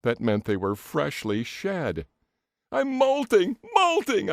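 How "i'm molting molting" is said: high, loud despairing wail; anguished scream. A narrator's reading. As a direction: wail it, broken and desperate. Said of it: "I screamed as I sank".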